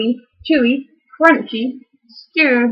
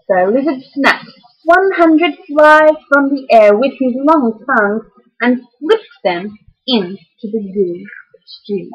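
ordinary delivery, nothing marked

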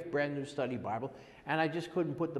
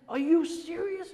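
high, loud, slowed disbelief; imitating a thrilled friend, pitch way up; excited; 6 dB louder than usual. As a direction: act out a friend bursting with excitement. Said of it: "He was so excited".

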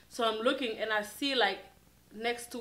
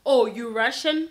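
louder, incredulous-sounding question with a rise; retold loud taunt; 7 dB louder than usual. Man outside calls through the car window, loud taunt. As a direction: imitate his loud challenge. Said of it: "he was talking so loud".

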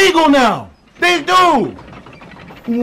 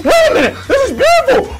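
excited; 10 semitones higher, faster than usual. Real, not acted. Streamer hyped by game reveal.

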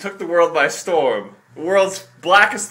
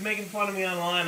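usual speaking voice

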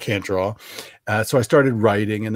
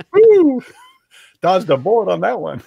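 loud high "Woo!" of relief; mock cheer; 9 semitones higher, 6 dB louder than usual. Playing the relieved ex, whooping. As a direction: celebrate like you escaped.

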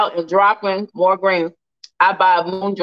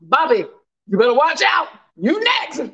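mock warning; 6 semitones higher than usual. Teasing a viewer.

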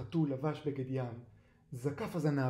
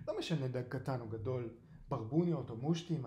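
plain reading voice

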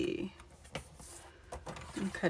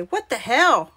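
louder, lightly voiced little kid; little kid quote; 3 semitones higher, 5 dB louder than usual. Crafter quoting a little kid, lightly imitated exclamation. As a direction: mimic a small child's exclamation, lightly. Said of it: "that little kid say".

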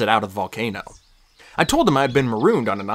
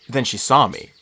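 usual speaking voice

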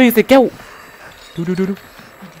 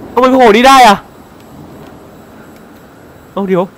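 ordinary talk

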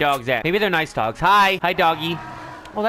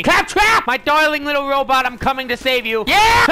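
high, loud call to a friend; hammed-up devotion bursting into a cheer; mock-heroic rescue cry; 5 semitones higher, 4 dB louder than usual. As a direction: rush in as the gushing rescuer. Playing rescuer of robot.

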